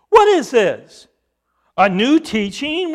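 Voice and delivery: loud, high, astonished questioning; amazed crowd. Acted. Crowd debating among themselves, loudly amazed.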